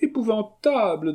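very high theatrical outcry; horrified exclamation. Acted. Painter veiling his face, high horrified cry. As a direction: exclaim aghast at high pitch.

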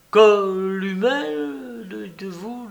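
high, slow, lingering on the word; fascinated hostess. A narrator's reading. Hostess asking the savant about his term, fascinated. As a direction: repeat it with drawn-out wonder. Madame Jujube savours the learned word.

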